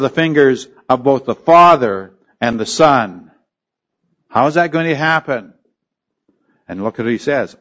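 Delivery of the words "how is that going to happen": ordinary delivery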